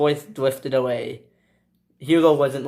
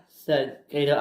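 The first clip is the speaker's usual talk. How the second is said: ordinary delivery, nothing marked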